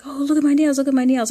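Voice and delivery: high, loud, thrilled gushing; gushing excitedly. Retold or imitated. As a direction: show off your nails, bursting with pride. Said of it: "and I'm like".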